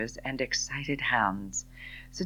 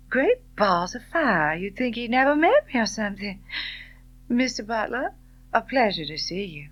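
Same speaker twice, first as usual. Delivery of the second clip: breezy disdain; 7 semitones higher, 3 dB louder than usual. A narrator's reading. Scarlett voiced, tossing words.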